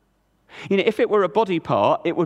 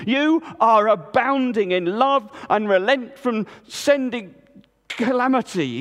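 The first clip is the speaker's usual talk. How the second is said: higher, resentful sneer; twisted with anger; 7 semitones higher than usual. A narrator's reading. Jonah sneering at God's mercy, bitter. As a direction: twist every syllable with spite. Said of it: "his own anger twisting every syllable".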